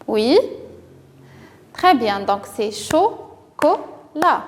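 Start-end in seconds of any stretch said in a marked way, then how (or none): 2.7-4.4 "chocolat" in three slow beats, cho, co, la; syllable by syllable. Shown as a demonstration. Teacher shows children how to count syllables, syllable by syllable. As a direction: break "chocolat" apart with a pause after each syllable. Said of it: "de combien de syllabes se compose".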